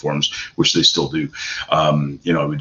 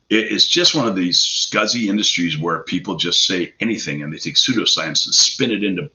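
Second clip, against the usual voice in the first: normal talking voice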